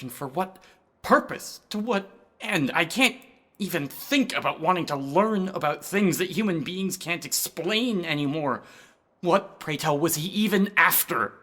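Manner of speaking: normal talking voice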